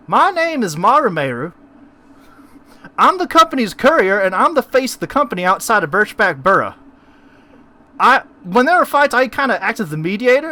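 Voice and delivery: high, loud and bright-eyed courier; a chirpy high character voice; high-pitched courier voice. Acted.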